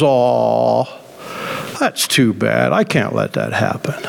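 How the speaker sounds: low, soft fake pity; mock sympathy, dropping low and soft.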